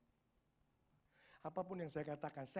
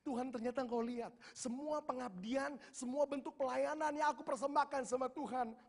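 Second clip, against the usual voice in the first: high-pitched, swelling cry to God; retold outpouring, pitched high and building; overflowing emotion; 5 semitones higher than usual. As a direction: relive an overwhelmed prayer, voice raised in wonder. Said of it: "meluap semuanya".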